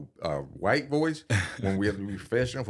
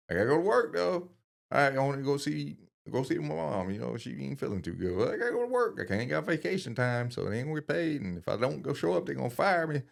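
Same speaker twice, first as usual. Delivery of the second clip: high, anxious voice of a trapped worker; stressed worker voice; 8 semitones higher than usual. Retold or imitated. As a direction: sound cornered by duty. Voicing his trapped past self.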